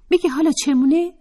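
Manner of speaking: high, loud, fast retort; Atefeh's voice. Acted. Atefeh retorting to Raheleh, high and loud. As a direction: snap the question back high.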